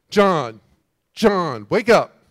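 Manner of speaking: loud call to wake a dozing friend; grumbling Jesus shouting to wake up John; calling loudly. Pretend. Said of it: "grumbling all the time".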